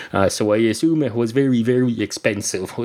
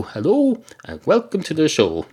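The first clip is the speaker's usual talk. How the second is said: Hello swooping up much higher, announcer style; official show opener; 5 semitones higher than usual. Shown as a demonstration. Host finally opening the show officially, announcer style. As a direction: open the show like a proper host. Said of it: "so I'll say it officially now".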